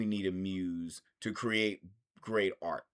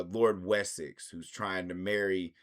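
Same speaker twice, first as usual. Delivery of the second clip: ordinary delivery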